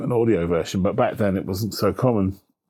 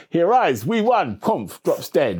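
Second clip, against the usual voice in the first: gasping messenger; 6 semitones higher, slower than usual. Retold or imitated. Retelling the marathon legend.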